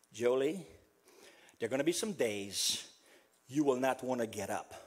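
low, soft pastoral address; hushed, unhurried comfort; tender consoling. Real, not acted. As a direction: speak low and gently to someone grieving. Consoling the bereaved Jolie directly.

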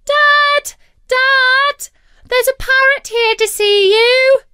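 put-on very high, loud little girl's yell; shouting child. A narrator's reading. Little girl calls her dad outside, shouting. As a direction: yell for Dad at full pitch.

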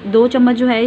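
usual speaking voice

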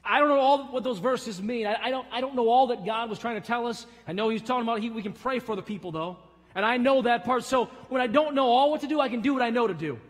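loud, high, driving pulpit voice; full-force preaching. Acted. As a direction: build it up with conviction.

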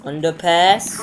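normal talking voice

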